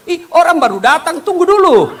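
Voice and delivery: high, loud startled protest. Acted. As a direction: blurt it out caught off guard. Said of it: "Kaget langsung dia bilang". (kaget, memprotes dengan suara tinggi dan keras)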